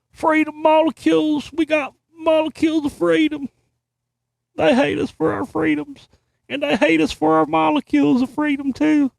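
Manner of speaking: high, sneering sarcastic patriot; mocking patriot voice. Host mocking the article's freedom rhetoric in a high put-on voice.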